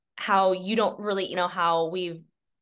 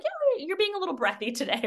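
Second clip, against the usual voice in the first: quoting feedback in a much higher voice; pitched way up, playful; high mock voice; 12 semitones higher than usual. Retelling instructor-training feedback.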